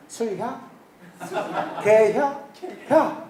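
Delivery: terse Chungcheong dialect questions, rising at the end. Shown as a demonstration. Preacher mimics Chungcheong elders offering drinks, dialect. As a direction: ask it the short Chungcheong way, with a lift on 혀. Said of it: "이렇게 안 물어봐요".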